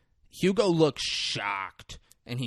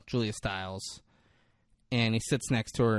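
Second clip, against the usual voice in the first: ordinary talk, nothing marked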